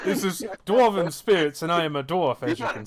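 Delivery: loud, affronted dwarf king; indignant dwarf king. Acted. Dwarf king correcting the guest who poured too little, loud and affronted. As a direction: puff up and correct the foreigner.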